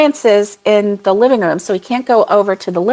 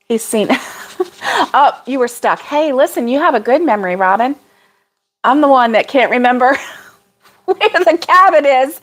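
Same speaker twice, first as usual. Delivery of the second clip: usual speaking voice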